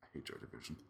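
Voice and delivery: almost whispered, unvoiced; quiet. Real, not acted. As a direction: say it under your breath. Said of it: "why did I say that quietly".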